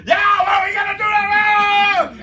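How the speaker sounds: very high, loud manic yell; too scary. Acted. Trainer parodies scary over-hype, high manic yell. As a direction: hype it up into a frenzied shout. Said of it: "'Cause if it's too scary".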